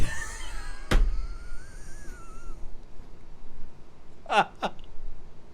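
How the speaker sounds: long, high wheezing laugh; breathless, squeaky fit of laughter; helpless laughing. Real, not acted. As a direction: lose it completely at the punchline. Collapses laughing after punchline.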